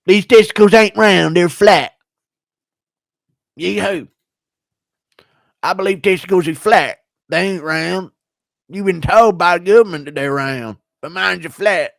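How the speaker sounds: hammed-up high impression with a yee-hoo; Bryce Mitchell. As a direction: mimic a fighter earnestly insisting, pitched well up. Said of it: "Impression of Bryce Mitchell drilling his own testicles".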